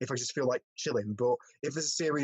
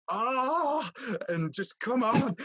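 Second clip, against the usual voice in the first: mock scream at TV; 9 semitones higher, much slower than usual. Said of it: "you're screaming at the television".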